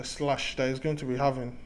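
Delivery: ordinary talk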